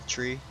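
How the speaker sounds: "tree" for three, th as plain t; Irish tree. Retold or imitated. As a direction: say "tree" with a hard t, no th. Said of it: "when they say three".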